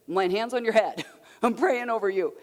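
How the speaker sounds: loud, half-laughing mock prayer; playful fatherly declaration. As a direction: declare it boldly, breaking into a laugh. Acts out dad praying over daughter.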